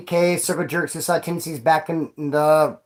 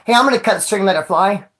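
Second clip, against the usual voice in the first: ordinary talk, nothing marked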